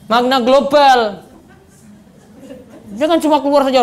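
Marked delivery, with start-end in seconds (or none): none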